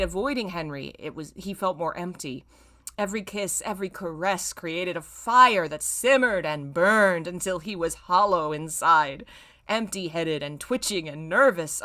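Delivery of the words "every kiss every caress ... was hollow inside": ordinary delivery, nothing marked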